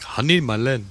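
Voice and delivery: exaggerated attempt at his accent; botched foreign accent, sounding vaguely Indian; badly faked accent. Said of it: "I can't do his accent".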